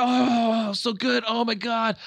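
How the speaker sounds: high, drawn-out moans of pleasure; mock ecstasy. Acting out pleasure in sex analogy.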